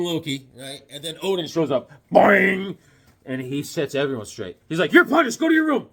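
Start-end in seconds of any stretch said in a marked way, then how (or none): 2.1-2.8 loud springy cartoon boing; drawn-out comic-book sound effect; boing noise. Odin pops up in the story, loud voiced boing. 4.9-5.9 high, loud mock-angry order; angry scolding. Odin sets everyone straight, angry parental scolding. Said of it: "See how angry he is?".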